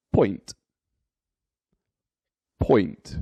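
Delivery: "point." said alone, with a falling tune; model pronunciation. Shown as a demonstration. Teacher showing learners how to read decimals, model pronunciation of "point.". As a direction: say "point." as one separate, clear word. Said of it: "the decimal point is pronounced as".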